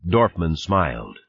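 ordinary delivery, nothing marked

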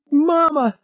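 high, frightened cry; shouted. Acted. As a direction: yelp it out in terror. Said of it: "Harry shouted".

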